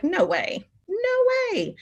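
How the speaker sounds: second no way leaps high in shock; can't believe the news; stunned disbelief. Retold or imitated. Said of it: "And I was like".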